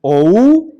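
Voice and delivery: O U with both vowels, loud and stretched; wrong reading. Shown as a demonstration. Teacher shows what is not read, loud stretched wrong diphthong. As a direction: sound out O U as o gliding into oo, loudly. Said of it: "no leemos esto".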